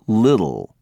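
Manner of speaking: little with the t flapped to a d, liddle; American little. Shown as a demonstration. Teacher showing students the American flap. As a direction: soften the t in little to a d. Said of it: "but an American says".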